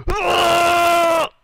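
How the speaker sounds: high anguished ugh shout; a real cry of rage; frustrated cry. Real, not acted. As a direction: let out a pained cry of frustration. Frustrated groan.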